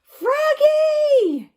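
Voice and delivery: high, loud alarmed yell. Acted. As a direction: stop him mid-swing. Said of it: "yelled his dad".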